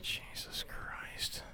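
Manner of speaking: slow, hushed exasperated whisper. Real, not acted. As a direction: breathe it out under your breath. Whispered reaction to the act.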